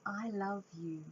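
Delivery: quiet, low and sincere; soft tender reply. Acted. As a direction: say it gently from the heart. Child replies softly.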